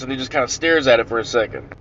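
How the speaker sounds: usual speaking voice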